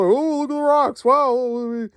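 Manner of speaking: high, airy mock wonder at the rocks; hammed-up high gasping awe; mock Indy gawking. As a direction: lampoon a hero gaping at scenery. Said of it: "he was like".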